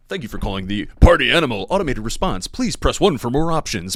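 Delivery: prank-call recorded greeting; automated phone message. Retold or imitated. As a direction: play the recorded phone system. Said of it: "think he was in the middle of an automated response".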